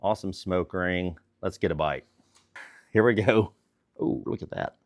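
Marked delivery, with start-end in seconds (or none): 4.0-4.8 whispering. Real, not acted. Awed at the cut meat.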